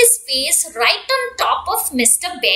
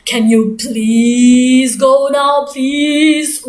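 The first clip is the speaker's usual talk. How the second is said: sleepy grumpy bear; 5 semitones lower than usual. Acted. Bear woken by duck at night, low grumpy groan. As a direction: drag it out like you were dragged from bed.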